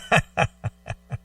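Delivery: short real laugh at a joke; amused laugh. Real, not acted. Cohost laughing at partner's outburst, short laugh. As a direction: laugh it off lightly.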